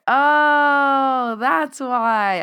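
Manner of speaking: high, loud "oh" of discovery; light up as it clicks; sudden realization. Retold or imitated. Retold moment of realization.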